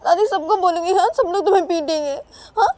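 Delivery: high, loud wailing threat; tearful Uma. Acted. Uma threatening to tell grandmother, acted tearful wail.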